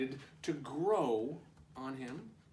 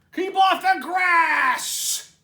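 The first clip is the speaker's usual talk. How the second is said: very high, loud, slow shout; 16 dB louder, much slower than usual. Acted. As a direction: holler it across the yard. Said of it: "shouted Hank".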